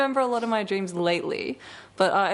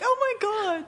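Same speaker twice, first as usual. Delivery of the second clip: very high, hammed-up dismay; mock outrage; 13 semitones higher than usual. Friend parodying her dream self's reaction, mock outrage. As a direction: parody an over-dramatic reaction.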